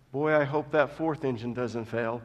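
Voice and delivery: quiet. Retold or imitated. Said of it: "one passenger turned to another and said".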